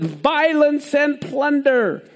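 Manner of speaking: high, drawn-out proclamation; mock shout. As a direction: cry it out slowly, pitched high. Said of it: "and I shouted".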